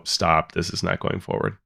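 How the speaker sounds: normal talking voice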